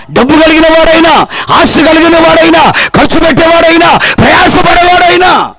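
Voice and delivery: stern warning climbing to a high cry; full-force preaching. Real, not acted. Preacher warning believers they must be made perfect, full-force preaching. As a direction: build the rebuke into high, driving preaching.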